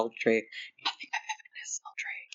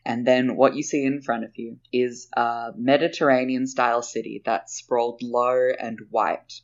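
game master narrating; 4 dB quieter than usual. Acted. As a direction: paint the scene for the players. Game master scene setting.

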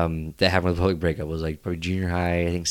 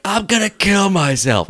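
slow, high, loud mock outburst; hammed-up despair; 11 semitones higher, 5 dB louder, slower than usual. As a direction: overplay the humiliated reaction for laughs. Retelling his overblown reaction to rejection.

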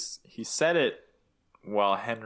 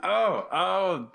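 startled; 8 semitones higher than usual. Real, not acted. Caught off guard by cue.

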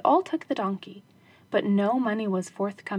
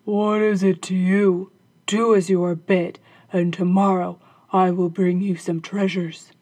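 cross captain, louder and lower; gruff, louder husband's rebuke; crossly; 3 semitones lower, 8 dB louder than usual. Acted. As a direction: snap at his wife, annoyed. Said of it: "he answered crossly".

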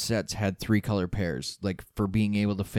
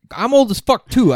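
loud, high protest; shouting; 13 dB louder than usual. Real, not acted. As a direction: blurt it out loud. Protesting being called old.

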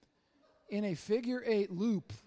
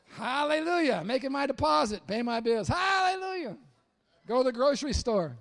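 higher, louder, hammed-up praise; overjoyed to pay the bills, tongue in cheek; mock hallelujah joy; 4 semitones higher, 7 dB louder than usual. Preacher acting cheerful at the bank, hammed-up joy.

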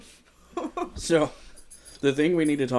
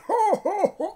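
jolly Santa laugh; slower than usual. Retold or imitated. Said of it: "This Santa doesn't sound like".

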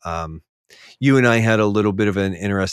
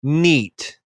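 sarcastic mock praise; 6 semitones higher, slower than usual. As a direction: fake enthusiasm at a predictable play. Mock-bored quote to opponents.

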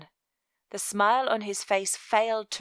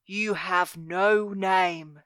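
cold, commanding guild master, drawn out; stern villain laying down the law; Master Velvet; 4 dB louder, slower than usual. A narrator's reading. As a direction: pronounce it as a menacing master, slow and deliberate.

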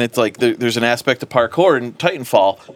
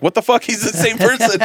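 shocked coroner; 9 semitones higher than usual. Retold or imitated. Coroner shocked at same actor.

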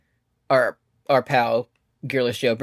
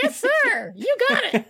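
a much higher mock voice for the eager booker; squeaky eager yes-man. Booker voiced as a comic figure.

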